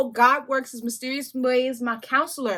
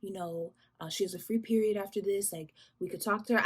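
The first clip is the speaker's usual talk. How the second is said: imitating the counselor, calm and softer; mimicking the calm counselor talking her down; calm low voice; 3 semitones lower, 8 dB quieter than usual. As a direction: soothe like a gentle counselor. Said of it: "like, this calm voice, like, low voice".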